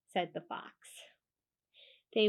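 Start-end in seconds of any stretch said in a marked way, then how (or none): none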